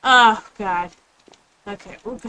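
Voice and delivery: normal talking voice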